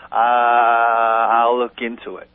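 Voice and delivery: long, slow stalling drone; mock dumbfounded hesitation, very drawn out; caught in the headlights. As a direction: imitate a stumped official dragging out the answer. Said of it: "so caught in the headlights".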